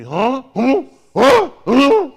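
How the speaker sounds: high, loud cartoon-dog huh, over and over; Scooby-Doo huh. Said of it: "your ass finna go Scooby-Doo".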